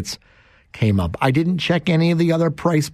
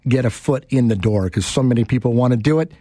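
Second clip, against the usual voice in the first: ordinary talk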